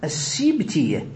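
السبتية said slowly with "sib", i after the sin; kasra on the sin. Shown as a demonstration. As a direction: say السبتية as as-sibtiyya, drawn out. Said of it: "كسر كلمة". (السبتية بكسر السين، ببطء)

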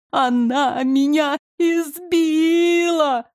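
high, drawn-out whining wail; fake crying wail. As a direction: wail it like a crybaby. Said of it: "самые настоящие слёзы".

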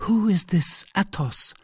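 high-pitched woman asking; wary heroine. A narrator's reading. Wife asks about the stranger whose rooms are offered, wary. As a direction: question him in a lifted female voice. Heroine questions proposed hiding place.

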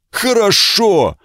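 high, slow beastly growl. Acted. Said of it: "прорычал он неразборчиво".

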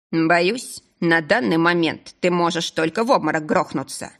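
usual speaking voice

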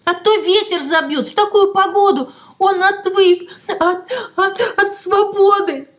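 high voice breaking on repeated words; tearful, faltering. A narrator's reading. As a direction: stumble through sobs. Steša crying, stumbling over words. (сквозь слёзы, запинаясь)

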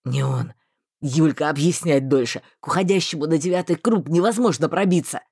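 no time to explain; low male voice. A narrator's reading.

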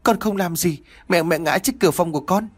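high-pitched, mildly distressed protest; a child's hurried, upset excuse; tearful stammering. A narrator's reading. Long explains to his furious father, mildly tearful stammering. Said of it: "miệng ấp úng".

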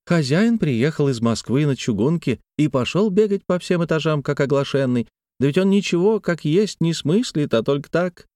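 chatty peasant woman Tatyana. Acted.

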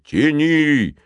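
high, drawn-out heave of a yell; straining shout. A narrator's reading. Giant hauling rope with boy, high strained shout. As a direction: shout it while pulling with all your might. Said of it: "закричал Зефир, напрягая все свои силы".

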